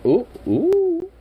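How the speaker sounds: high excited ooh ooh; excited squeal. Real, not acted. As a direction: squeal with delight. Excited at dog.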